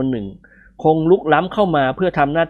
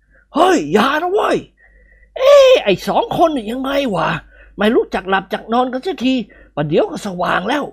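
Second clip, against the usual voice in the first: put-on high, cracked scolding old man; old nobleman's voice; 10 semitones higher than usual. A narrator's reading. Old lord scolding the noisy friends, high cracked voice.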